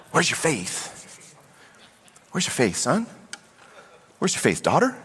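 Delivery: soft, tender question; gentle questioning. Acted. Jesus to a son or daughter, soft and gentle. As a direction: ask it gently, softening the voice.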